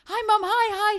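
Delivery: high, loud, rushed greetings to mum; breathless excited hi. Retold or imitated. Son calling his mum, bursting with news. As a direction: gush hello, bursting with news. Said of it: "and I said".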